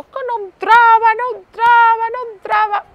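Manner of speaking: put-on loud, high child's wail; high-pitched, tearful-child imitation at full volume; wailing little girl. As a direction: wail it like a frustrated toddler. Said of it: "la niña dijo".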